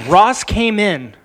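slow, dramatic results reveal; winner announcement. Acted.

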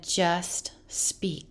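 breathy whispered voice of the Spirit; gentle whisper. Retold or imitated. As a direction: whisper it softly, like an inner voice. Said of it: "and he said".

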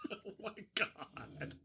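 breathless whispered oh my God, slowed down; co-host reacts to an outrageous joke in a shocked whisper; stunned whisper. Real, not acted. Shocked aside after edgy joke.

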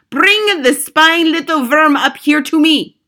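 slow, loud, high witch voice; shouted command. A narrator's reading. Grand High Witch demands the spying boy be brought, shouted command. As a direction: shout each word with contempt, drawn out. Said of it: "shouted the Grand High Witch".

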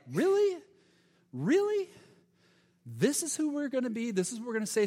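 mock them in sharp high disbelief; sarcastic disbelief. James scoffs at believers who only offer words, sarcastic. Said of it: "the sarcastic tone a little bit".

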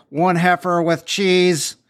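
slow, drawn-out announcement into a microphone; mock drive-thru mic call. Grill guy calling an order over the microphone, slow. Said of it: "goes into the microphone and goes like".

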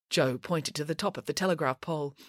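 usual speaking voice

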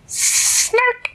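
very high, loud creature squeal; snark call. Acted. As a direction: make a sudden piercing little creature cry. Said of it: "The sound went like this".

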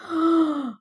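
sharp intake of breath; acted gasp of disbelief; shocked gasp. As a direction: gasp as if stunned by news. Retold shock at good-news tone.

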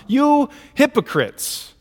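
drawn-out reproach, still indignant; indignant rebuke. Retold or imitated. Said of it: "gets indignant himself".

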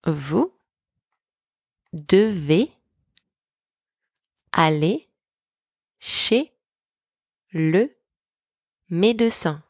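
each word on its own, long pauses between; teacher's slow dictation for repeating. Shown as a demonstration. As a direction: spell out the sentence one word at a time. Word-by-word breakdown for repetition.

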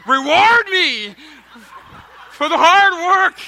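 slow, loud, high mock longing; hammed-up slow plea. Pastor joking to congregation about wanting reward, hammed-up slow plea. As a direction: drag it out like a swooning hero.

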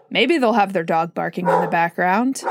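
ordinary delivery

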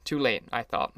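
ordinary delivery, nothing marked

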